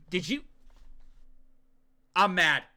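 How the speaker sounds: ordinary delivery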